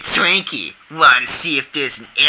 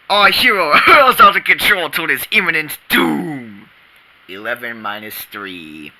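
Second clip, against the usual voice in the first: dramatic announcer; 6 semitones higher than usual. Acted. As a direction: narrate like a sci-fi trailer. Spiff narration.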